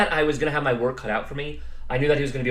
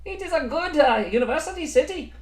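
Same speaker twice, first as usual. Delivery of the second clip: mock German accent, pitched well up; hammed-up German accent, high and bright. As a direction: play a cheerful German praising Heidelberg with a thick German accent. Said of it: "They all say".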